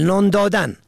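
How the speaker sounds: usual speaking voice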